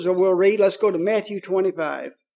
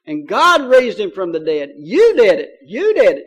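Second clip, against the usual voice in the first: forceful preaching, each "you" punched loud; emphatic charge, building to loud jabs; retold accusation; 5 dB louder than usual. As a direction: imitate an apostle accusing a crowd. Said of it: "He pointed his fingers at these people".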